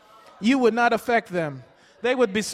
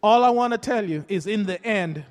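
ordinary delivery

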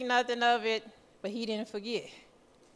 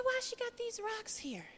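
puzzled; 11 semitones higher, 7 dB quieter than usual. Retold or imitated. Puzzled at landlord's rocks.

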